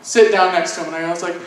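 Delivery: ordinary talk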